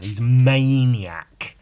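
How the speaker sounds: slow loud drawn-out awe; amazed. Real, not acted. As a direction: marvel at the madman. Marvelling at the artist.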